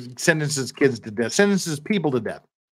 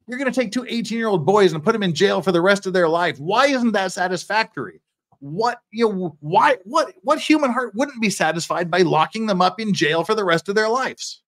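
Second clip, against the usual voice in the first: raised, incredulous pleading; indignant questioning; 6 semitones higher, 3 dB louder than usual. Acted. Defender arguing jail for life should satisfy, indignant questioning. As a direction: stumble into insistent, high rhetorical questions.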